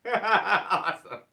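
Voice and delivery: bursting out laughing, slowly; delighted laugh into the word. Real, not acted. Delighted reaction to good news.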